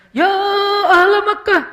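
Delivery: imitating a khatib yelling to the crowd; preacher's shouted call. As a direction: belt it out like a fiery preacher. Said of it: "Khatib ... berubah nadanya".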